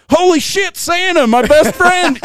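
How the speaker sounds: high, excited reindeer voice; a giddy animal meeting its hero; mock-thrilled reindeer squeal; voicing the reindeer. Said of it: "it's like".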